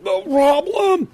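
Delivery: very high, loud, drawn-out holler; calling out. Acted. Sick old man stumbling out the door, hollering back. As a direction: call it back high and stretched, in a hurry.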